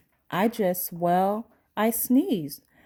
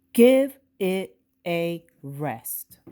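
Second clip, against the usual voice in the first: stern dad; 5 dB louder, slower than usual. Acted. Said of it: "He said".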